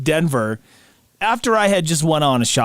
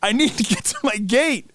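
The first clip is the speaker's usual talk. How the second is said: higher-pitched, pressing plea; exasperated and pressed for time; urgent plea; 6 semitones higher than usual. Retold or imitated. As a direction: imitate an anxious traveler in a hurry. Retold plea to hurry.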